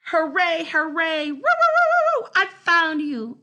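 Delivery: high, loud yelping cheer; dog cheering on finding the girl; overjoyed cheering. Acted. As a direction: bark out pure delight. Dog celebrates finding Hattie.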